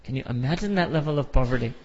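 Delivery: usual speaking voice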